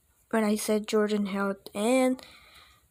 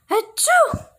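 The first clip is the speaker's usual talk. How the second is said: high loud acted sneeze; big sneeze; 5 dB louder than usual. A narrator's reading. Cheerleaders sneezing on the field, high loud sneeze. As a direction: let the sneeze explode. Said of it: "sneezed the cheerleaders".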